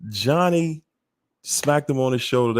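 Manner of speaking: normal talking voice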